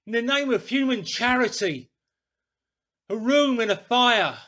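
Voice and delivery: loud, high, desperate plea for warmth; pleading shout. A narrator's reading. Stranger demanding a room at the inn, shouting. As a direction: shout it like a man near frozen. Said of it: "he cried".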